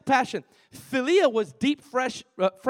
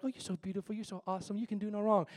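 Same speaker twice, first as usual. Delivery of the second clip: much softer, sweet-talking a spouse; honeymoon cooing; 8 dB quieter, faster than usual. Retold or imitated. A newlywed cooing to a spouse, soft. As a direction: murmur it like a newlywed in love. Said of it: "the honeymoon love is like".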